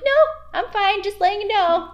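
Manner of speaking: very high, loud squeak of reassurance; mock-breezy refusal. Employee turning down boss's offer to come up, high mock-breezy refusal. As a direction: overdo the 'I'm fine' in a high, loud voice.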